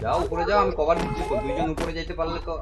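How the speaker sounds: loud, high urging call; cover me shout. Real, not acted. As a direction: push them forward, shouting. Urging teammates up under fire.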